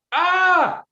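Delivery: high, loud yelp of fright; shocked scream. Acted. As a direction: Gordon grabbed by soldiers, shrieking scream. Gordon seized from behind.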